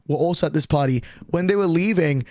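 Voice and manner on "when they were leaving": ordinary talk, nothing marked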